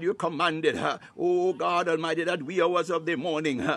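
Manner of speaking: ordinary talk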